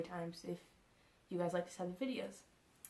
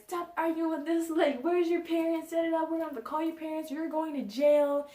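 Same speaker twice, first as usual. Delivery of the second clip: loud, high-pitched mock telling-off; hammed-up angry authority voice; imitated yelling guard; 9 semitones higher, 9 dB louder than usual. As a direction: scold the kids at full volume, mimicking security. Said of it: "keep yelling at us".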